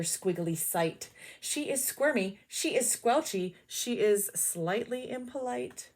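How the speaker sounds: ordinary delivery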